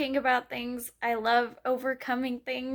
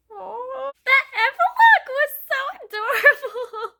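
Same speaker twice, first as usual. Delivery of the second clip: higher and louder, laughing and teary; gushing squeal; 9 semitones higher, 6 dB louder than usual. Real, not acted. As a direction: burst out in delighted half-sobbing praise. Gushing after finishing the epilogue.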